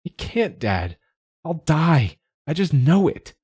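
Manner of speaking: high, tearful, desperate; tearful pleading. A narrator's reading. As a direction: plead through tears. Crying child after breakdown.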